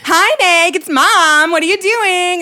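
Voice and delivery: loud, high phone-greeting mom; chirpy mom impression. Daughter imitates mom on the phone, loud chirpy mom. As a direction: imitate an overeager mother calling. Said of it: "She'll be like".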